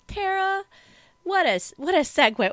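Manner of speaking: high, loud mock praise for herself; congratulatory. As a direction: gush with fake congratulations.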